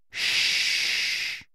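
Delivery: long, soft hushing breath; soothing shush. A narrator's reading. Guardian calming a trembling boy, soft shush. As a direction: hush a child gently.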